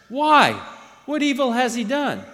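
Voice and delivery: high, loud incredulous question; challenging protest. A narrator's reading. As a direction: demand a reason from the crowd. Pilate challenges the shouting crowd.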